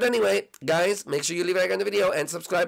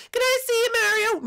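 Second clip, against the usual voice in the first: squeaky, eager falsetto request; Peach; 3 dB louder than usual. Acted. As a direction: ask in a very high princess voice. Peach asks to see it.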